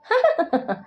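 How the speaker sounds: high mocking burst of laughter. A narrator's reading. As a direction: laugh her down. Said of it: "పక్కపక్క నవ్వింది".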